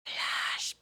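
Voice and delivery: whispered dramatic word; whispering. Retold or imitated. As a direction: breathe it out like a secret. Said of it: "Aaron at one point ... goes".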